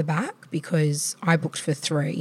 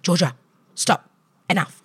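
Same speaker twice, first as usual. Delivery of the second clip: put-on firm, louder snap at a child; mock-stern, louder, cut it short; losing it; 4 dB louder than usual. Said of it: "And I lost it".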